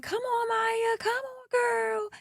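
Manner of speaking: thin, high-pitched retold encouragement; cheering someone on. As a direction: imitate friends egging a girl on from afar. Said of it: "their mama just cheering them on".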